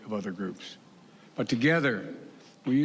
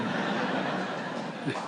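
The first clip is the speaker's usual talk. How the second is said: a short breathy laugh at his own joke; chuckle; 3 dB quieter than usual. Real, not acted. Joke about wife not coming home.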